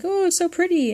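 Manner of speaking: high, delighted squeal of praise; gushing. Retold or imitated. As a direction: swoon over something pretty. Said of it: "and I'm like".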